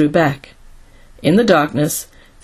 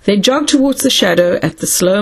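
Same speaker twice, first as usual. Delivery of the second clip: plain reading voice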